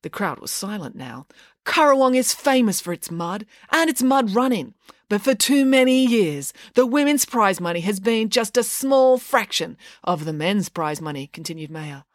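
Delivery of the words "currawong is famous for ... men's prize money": high, measured rallying address; campaigner's oratory, pitch held up; public speech. A narrator's reading. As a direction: make your case to the crowd. Maya's speech to the crowd.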